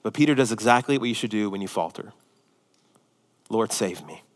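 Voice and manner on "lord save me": soft murmured prayer. Acted. Quiet prayer example.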